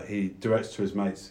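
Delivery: normal talking voice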